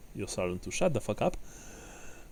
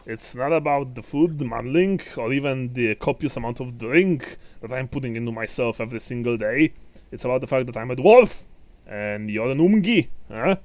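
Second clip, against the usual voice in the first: loud, higher boisterous dwarf; 4 semitones higher, 10 dB louder than usual. Acted. Dwarf teases manling about huffing and puffing, boisterously. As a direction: tease loudly as a proud dwarf.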